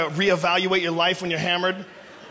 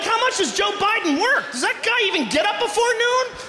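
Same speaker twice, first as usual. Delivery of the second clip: mock indignant; 11 semitones higher than usual. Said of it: "I'd be like".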